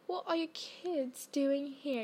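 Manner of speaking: whispered, slow imitation of Snape's sneer; Snape impression. Snape catching two kids out of bounds, whispered and slow. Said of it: "in his, like, accent".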